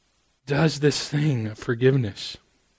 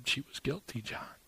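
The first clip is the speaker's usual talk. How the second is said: dropping to a near-silent hush; Jesus quietly tells John; hushed aside; 13 dB quieter than usual. Acted. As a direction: lean in and say it almost under the breath.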